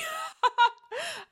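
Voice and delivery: sudden high disbelieving laugh; high incredulous laugh. Acted. A mistress laughing at being called a monster.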